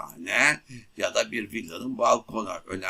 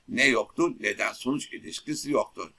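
usual speaking voice

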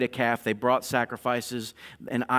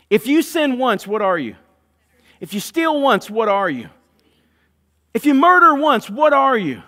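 building rhetorical questions; 9 semitones higher, 9 dB louder than usual. Acted.

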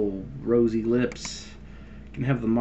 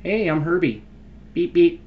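Herbie the car; 6 semitones higher, 3 dB louder than usual. Pretend. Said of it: "It can be a car".

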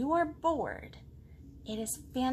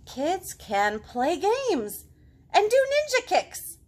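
much higher, louder, slow; eager kid; 8 semitones higher, 7 dB louder, slower than usual. Acted. Girl in the book boasting to the potato, high and loud. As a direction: show off proudly. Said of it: "girl boasts".

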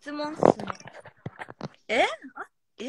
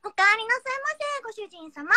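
high, sugary maid greeting; sugary maid cafe greeting; 9 semitones higher than usual. Pretend. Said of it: "ぶりっ子でブリブリって".

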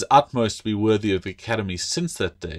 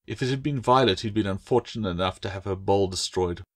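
ordinary delivery, nothing marked

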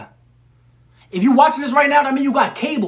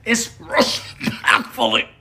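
"respectfully" said slowly, meaning every syllable; meant respectfully; 3 dB quieter, much slower than usual. Shown as a demonstration. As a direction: lean into "respectfully" like you mean it. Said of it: "You gotta mean it".

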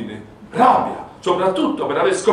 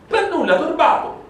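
ordinary delivery

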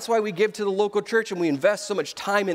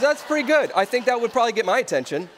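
higher and louder, amused approval; acted impressed reply; 6 semitones higher, 4 dB louder than usual. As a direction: retell it as a delighted concession.

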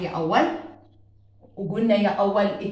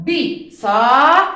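بساط drawn out very slowly and loudly, the ط at the end clearly separate; slowly stretched; 6 dB louder, much slower than usual. Shown as a demonstration. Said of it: "وين لفظت القط يا أول؟".

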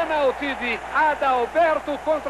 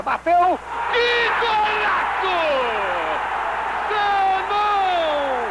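high excited goal cry; explosive goal commentary; 6 semitones higher, much slower than usual. Acted. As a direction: call the golaço up high. Golaço called out.

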